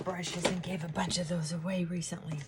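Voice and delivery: unvoiced whispered aside. Real, not acted. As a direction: whisper it softly to the camera. Whispering aside while doing makeup.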